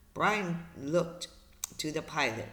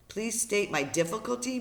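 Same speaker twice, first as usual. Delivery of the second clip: higher-pitched, disbelieving echo; incredulous echo; 6 semitones higher than usual. Acted. Brian thinks over the operator's request, incredulous echo. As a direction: throw the words back in disbelief. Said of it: "Brian thought".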